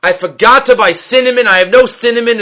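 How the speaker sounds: mock-tearful confession without real sobs; retold distress. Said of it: "A woman's crying, crying, crying".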